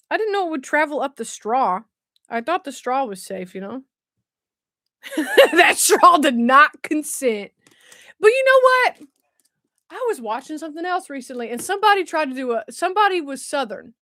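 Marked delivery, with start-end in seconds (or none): 5.5-9.1 slow, loud, through laughter; laughing. Real, not acted. Streamer joking about fizzy soda up the straw, laughing. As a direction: draw it out while cracking up.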